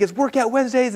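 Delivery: high-pitched imitation of a hyped yell; a light imitation of the wheelchair guy's pumped-up shout; playful mock announcer yell. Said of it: "and he's yelling".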